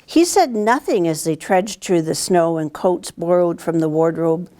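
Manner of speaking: usual speaking voice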